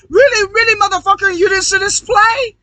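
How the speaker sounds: high, loud mocking jeer; taunting outburst. Real, not acted. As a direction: rub it in their faces. Taunting the audience.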